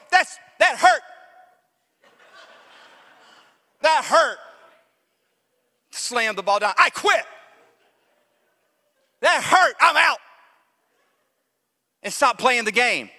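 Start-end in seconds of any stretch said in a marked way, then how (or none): 0.1-4.4 high, hurt, mock-offended complaint; offended whine. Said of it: "I'm offended and I go". 6.8-10.2 high, loud hammed-up huff; offended quitting. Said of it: "I'm offended and I go".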